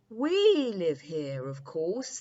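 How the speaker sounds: the dreams answer grandly and slowly; dream voices. Acted.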